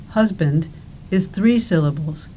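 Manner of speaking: ordinary talk, nothing marked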